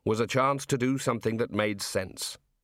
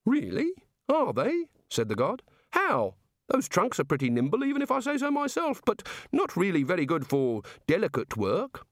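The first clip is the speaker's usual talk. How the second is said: high, eager voice of the god; high-pitched, fussy god voice; 7 semitones higher than usual. Acted. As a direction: play the chatty, enthusiastic creator god. The god speaks.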